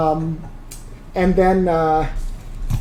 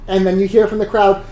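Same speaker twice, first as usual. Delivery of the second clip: usual speaking voice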